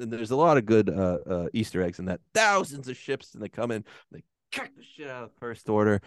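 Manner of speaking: normal talking voice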